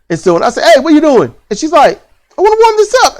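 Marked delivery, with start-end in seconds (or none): 0.6-1.3 sharp call. Retold or imitated. Calling out to daughter. 2.4-3.2 pitched-up mock daughter; high daughter voice.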